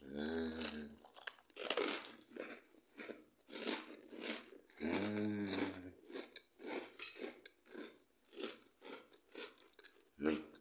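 humming while chewing; savoring with a full mouth. Real, not acted. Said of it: "Desculpa falar de boca cheia". (de boca cheia, mastigando)

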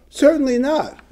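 certainly not, high and firm; high emphatic rebuttal; emphatic denial. Shown as a demonstration. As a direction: slam the door on the idea. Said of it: "I'm putting my words".

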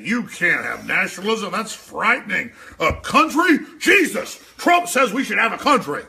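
loud, high sneering parody; mocking Beck. Host parodying Beck's horror at nationalism, loud high sneer. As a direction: shriek it like a horrified pundit. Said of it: "Beck was today".

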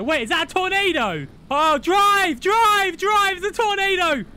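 rising from high alarm to full screamed urgency; panicked shouting. Real, not acted. Passenger screaming to drive from tornado, panicked. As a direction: build to a very high, loud panic.

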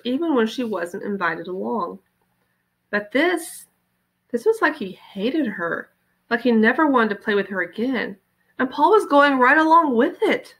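usual speaking voice

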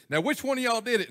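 mild mock mama scold; mama yelling. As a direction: demand it like a mad mom. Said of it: "Mama's yelling at 'em".

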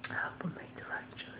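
whispered hope, all breath. Real, not acted. Hoping for right choices. (soft whispered hope)

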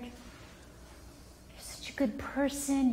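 slow, soft whispered reassurance; hushed loving parent; whispered praise. Shown as a demonstration. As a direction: whisper it like a secret comfort.

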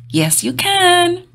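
high, slow, rousing cheer; emphatic encouragement. Real, not acted. Cheering on young viewers' dreams, high and slow. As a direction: lift them up with belief.

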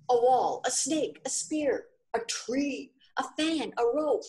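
high, clashing angry shouts; angry quarrelling voices. Acted. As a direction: let the list tumble out as an angry row. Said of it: "The sound of angry voices".

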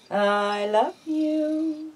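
"I love you" drawn out very slowly, high, leaning on "love"; slow drawn-out model. Shown as a demonstration. Owner teaching her pet bird the phrase, slow sing-song drawl. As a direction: stretch every word so the bird can copy it. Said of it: "Tell me".

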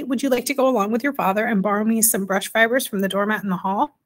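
ordinary delivery, nothing marked

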